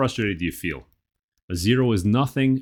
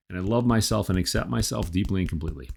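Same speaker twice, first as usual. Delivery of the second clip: ordinary talk, nothing marked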